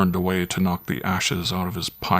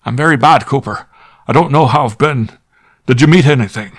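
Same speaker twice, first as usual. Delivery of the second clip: shaken squire; 7 semitones higher, 9 dB louder than usual. Acted. Shaken squire after ghostly ringing.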